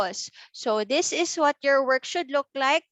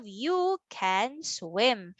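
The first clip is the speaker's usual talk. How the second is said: slow, words stretched and set apart; word-by-word reading; 2 semitones lower, 4 dB quieter, much slower than usual. Shown as a demonstration. Teacher reads the next sentence, word by word. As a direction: read it slowly for beginners. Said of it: "how about we read our sentence".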